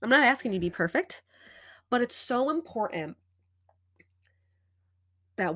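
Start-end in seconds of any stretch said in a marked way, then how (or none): none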